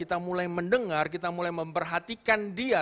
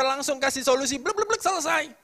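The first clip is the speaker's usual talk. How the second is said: mocking; 9 semitones higher, 5 dB louder than usual. Mocking quick advice.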